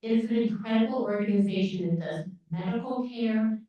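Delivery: ordinary delivery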